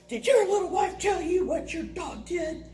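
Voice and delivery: high-pitched huffy confrontation; mock aggrieved man; acted indignant neighbor. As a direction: confront the husband in a put-on voice. Bitten man confronts the husband.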